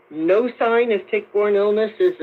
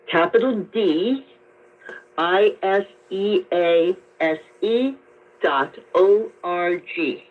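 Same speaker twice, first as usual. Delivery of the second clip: slow, deliberate dictation with pauses; slowed-down spelling. Real, not acted. Doctor restating a website for a caller, slow and careful. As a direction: spell it out slowly, piece by piece. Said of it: "You said that so fast".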